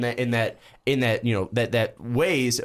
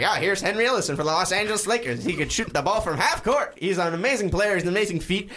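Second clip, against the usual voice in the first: put-on high, bright old-time radio announcer; 1950s announcer voice; 7 semitones higher than usual. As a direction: hype him up like a 1950s newsreel. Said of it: "You got a really good nineteen fifties announcer voice".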